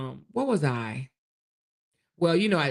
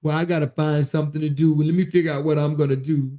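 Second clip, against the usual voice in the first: sulking husband; 2 semitones lower, faster than usual. Retold or imitated. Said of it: "He was like".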